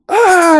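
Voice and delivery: high hammed-up yelp of panic; mock scream. Neo panicking over the bug, a high mock scream. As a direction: squeal like you found a bug inside you. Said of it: "he's all freaking out".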